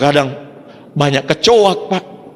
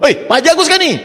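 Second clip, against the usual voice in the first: excited caller; 13 semitones higher, faster than usual. Retold or imitated. Caller phones from Malaysia.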